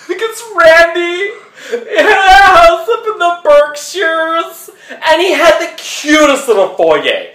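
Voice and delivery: hammed-up high, loud sobbing quote; mock crying. Recalling ex Randy's house to the audience, hammed-up high, loud crying. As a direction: wail about the ex's foyer in mock tears. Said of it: "I'm sad".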